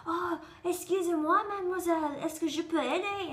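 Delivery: helpful Parisian. Retold or imitated. Parisians approach her on the stairs, helpful imitation. Voices Parisians offering help.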